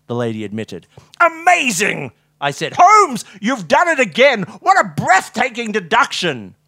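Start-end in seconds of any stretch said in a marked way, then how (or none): 1.2-2.1 loud, high exclamation; gushing amazement. A narrator's reading. Watson praises Holmes's trivial observation, wildly impressed. 2.8-6.5 high, loud, overexcited praise; gushing admiration. A narrator's reading. Watson hails Holmes's non-deduction, loudly overawed.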